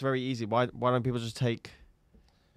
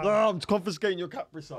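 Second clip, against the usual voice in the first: high-pitched pretend scolding; mock outrage; 10 semitones higher, 3 dB louder than usual. Podcaster punishing co-host over lost guest, high mock outrage. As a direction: wail the threat in hammed-up despair.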